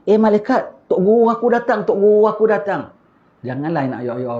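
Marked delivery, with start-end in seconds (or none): 0.1-2.9 high, eager voice of the dead greeting his teacher; the departed soul exclaiming, high and excited; dead man's awed cry. Retold or imitated. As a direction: cry out in wonder, pitched well up. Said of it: "ini mesti dia bilang malaikat lah".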